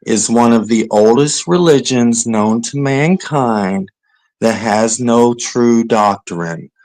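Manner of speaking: slow, deliberate explaining; every syllable spelled out; over enunciating. Shown as a demonstration. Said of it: "I'm going to say it slowly so you understand".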